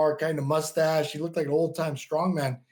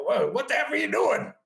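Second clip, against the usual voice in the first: higher, annoyed mock cussing; retold angry yell; 8 semitones higher than usual. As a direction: snap it like an irritated gym owner. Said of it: "cusses at me".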